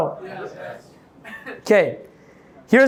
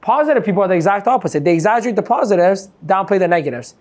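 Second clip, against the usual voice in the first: usual speaking voice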